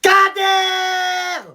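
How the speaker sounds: shouted summons. Acted. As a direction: bellow for the servant. Prince hurle son serviteur. (prince qui hurle le nom de Kader)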